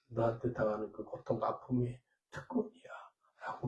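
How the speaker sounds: hushed whisper of God's voice; preacher whispering what the Lord told him. Retold or imitated. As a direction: breathe it like a secret from above. God's words retold to congregation.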